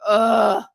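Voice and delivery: loud, exaggerated sigh; big sigh of relief. Streamer retelling getting off stream, biggest sigh. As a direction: act out a huge relieved exhale. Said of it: "took the biggest sigh".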